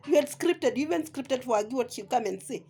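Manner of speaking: ordinary talk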